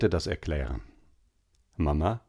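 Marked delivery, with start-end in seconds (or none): none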